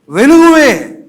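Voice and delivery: high, loud cry of వెలుగుని; triumphant shout. Real, not acted. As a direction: declare వెలుగుని as a shouted victory. Answers own question triumphantly. (ఉత్సాహంతో గట్టిగా అరుపు)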